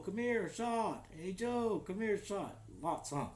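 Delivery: ordinary talk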